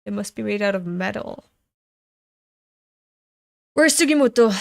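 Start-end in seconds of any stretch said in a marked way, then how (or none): 3.7-4.5 a loud, higher shout across the field; calling out. Acted. Soldier shouting out to find Sugimoto, loud. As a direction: call out looking for him.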